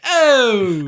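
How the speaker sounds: high, loud, drawn-out New York parody; Andrew Dice Clay. Retold or imitated. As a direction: belt out a hammed-up comic's catchphrase. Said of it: "Do an Andrew Dice Clay impression".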